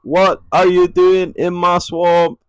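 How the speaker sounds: high, loud, slow Scottish growl of an ogre; Shrek impression. Shown as a demonstration. Trying a second impression for the co-host, loud Scottish ogre. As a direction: bellow it like an ogre guarding his swamp. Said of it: "maybe Shrek?".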